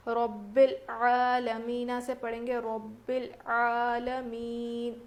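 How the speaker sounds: slow melodic tajweed recitation of رب العالمین; slow melodic Quranic chant. Shown as a demonstration. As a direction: chant رب العالمین slowly, drawing out the vowel. Model recitation.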